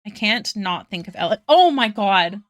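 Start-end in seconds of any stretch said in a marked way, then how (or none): 1.5-2.4 loud, startled outburst; sudden jolt of surprise; startled exclamation. Real, not acted. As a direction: blurt it out in shock. Startled by sudden stream alert.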